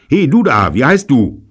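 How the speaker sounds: high, loud call; sharp challenge. A narrator's reading. Robert questions the German soldier, loud challenge. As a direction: challenge the stranger loudly. Robert roughly addresses the prisoner.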